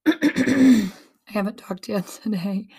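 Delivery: hoarse, losing her voice. Real, not acted. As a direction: speak through a hoarse, worn-out voice. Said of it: "I have been losing my voice".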